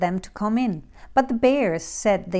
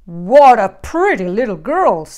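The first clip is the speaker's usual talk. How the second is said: growling big bear; 10 dB louder than usual. A narrator's reading. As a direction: growl admiringly as Papa Bear. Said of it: "growled the bear".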